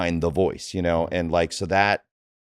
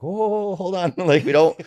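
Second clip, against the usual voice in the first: imitating the director, much higher "whoa, hold on"; director reining him in; 11 semitones higher than usual. Director stopping the actor getting aggressive, high mock call. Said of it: "and he'd be like".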